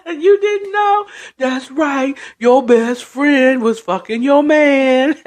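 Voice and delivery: high, drawn-out gloating with cackles; gleeful taunting. Retold or imitated. Mom pointing at Toy over best friend, taunting. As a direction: crow it slowly through mocking laughter. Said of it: "the mom is just taunting".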